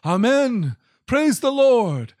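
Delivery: high, slow exultant cry of amen; crying out praise. A narrator's reading. Elders fallen before the throne crying out, high exultant praise. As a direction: cry it out in worship. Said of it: "They cried out".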